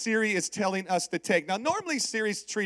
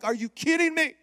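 high-pitched, incredulous retort; retold outburst at the GPS; exasperated yell; 8 semitones higher than usual. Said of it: "I'm yelling at Siri".

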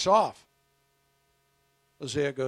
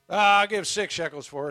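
loud bidder; 6 semitones higher, 10 dB louder than usual. Acted. Bidder in auction.

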